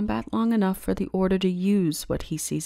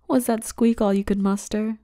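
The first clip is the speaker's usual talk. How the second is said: mocking tease; 3 semitones higher, 6 dB louder than usual. Mocking the captive's squeak.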